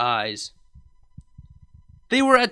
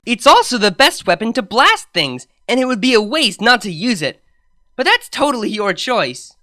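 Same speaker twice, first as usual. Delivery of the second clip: eager; 6 semitones higher, 7 dB louder than usual. Acted. Eager pitch for blasting.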